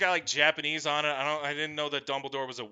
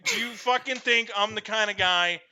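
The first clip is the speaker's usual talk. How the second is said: usual speaking voice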